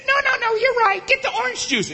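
very high, bossy little squeak; tiny Minnie Mouse voice. Retold or imitated. Tiny wife ordering her big husband at McDonald's, Minnie Mouse voice. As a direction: order him about in a cartoon-mouse falsetto. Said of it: "tiny little Minnie Mouse voice".

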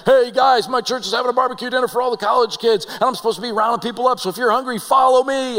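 high, loud, quick announcement to the room; rallying people. Retold or imitated. Church guy invites college kids to dinner, rallying them loudly. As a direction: round up hungry students with a holler. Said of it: "he's like".

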